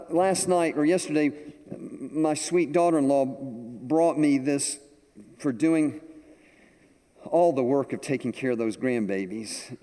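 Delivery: usual speaking voice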